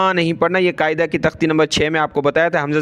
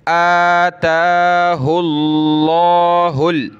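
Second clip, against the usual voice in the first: slow sung recitation, a bit louder; Quran recitation; 3 dB louder, much slower than usual. Shown as a demonstration. Teacher recites the verse slowly for pupils. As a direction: intone it slowly like tilawat.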